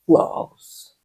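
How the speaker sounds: a soft whisper to show the ease. Shown as a demonstration. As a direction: whisper "flows" softly. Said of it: "until it".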